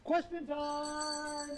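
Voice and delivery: very high, long-held announcement; calling out. Acted. Streamer announcing questions after ringing a bell, high drawn-out call. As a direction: sing it out high and stretch the ending.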